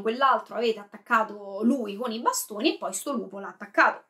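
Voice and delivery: usual speaking voice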